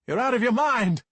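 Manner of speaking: very high, loud furious shout; raging yell. Acted. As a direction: hurl it at your father. Raging kid yells at father.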